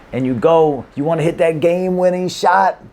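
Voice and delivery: ordinary talk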